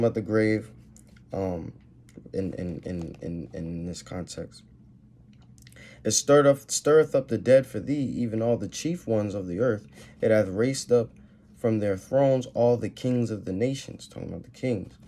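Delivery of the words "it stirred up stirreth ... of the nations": raised, measured scripture read; loud King James reading. Acted. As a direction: read the verses out like a preacher. Reads scripture aloud in sermon.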